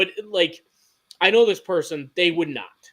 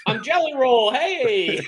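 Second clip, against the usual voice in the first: high, louder, hammed-up hello; 9 semitones higher, 4 dB louder, much slower than usual. Joking to friends that he passes for the singer, hammed-up greeting. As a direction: announce yourself as a jokey stand-in star.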